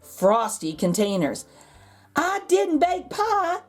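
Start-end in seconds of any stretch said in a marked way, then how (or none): 2.1-3.6 calling out laughing. A narrator's reading. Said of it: "she calls out laughing".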